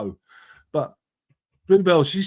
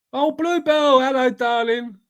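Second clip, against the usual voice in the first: fond greeting; 11 semitones higher, 4 dB louder than usual. Real, not acted. Greeting a guest fondly.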